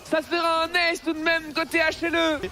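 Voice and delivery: high and still buzzing; excited caster. Real, not acted. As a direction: keep riding the hype. Caster still hyped after fight.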